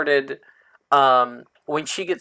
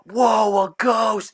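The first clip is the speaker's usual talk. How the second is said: startled exclamation; 7 semitones higher, much slower than usual. Acted. Player character sees a ghost up close, startled cry.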